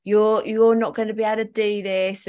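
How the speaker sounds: usual speaking voice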